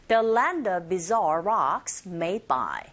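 slow model with buzzing z in "bizarre"; teacher slowly models the phrase; slow drill line. Shown as a demonstration. Teacher drills the phrase slowly for students to repeat. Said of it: "listening one".

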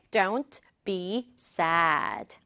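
very slow, separated words. Shown as a demonstration. Teacher introducing sentence two, slow word by word. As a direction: give "Don't be sad" one slow word at a time.

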